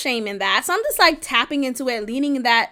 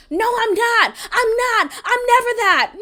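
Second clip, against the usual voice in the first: mock defensive denial; 7 semitones higher than usual. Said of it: "they're expecting your response to be".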